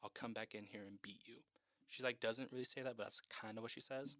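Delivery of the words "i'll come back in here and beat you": quiet threat. Retold or imitated. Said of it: "And she's like".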